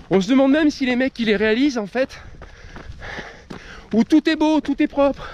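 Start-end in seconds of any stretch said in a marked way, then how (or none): 3.9-5.3 mocking idealised tone. Mocking perfect training plans.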